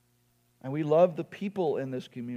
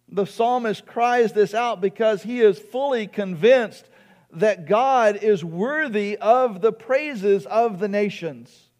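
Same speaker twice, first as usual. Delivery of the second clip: raised, louder preacher's voice; impassioned preaching; 6 semitones higher, 7 dB louder than usual. Real, not acted. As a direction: preach it high and emphatic. Preaches with rising force.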